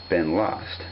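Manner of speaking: usual speaking voice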